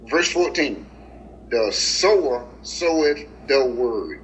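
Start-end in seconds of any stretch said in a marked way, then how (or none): 1.5-4.2 very slow, every word weighed; slow solemn reading. Shown as a demonstration. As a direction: read it slowly, savouring each word. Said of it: "Glory to God".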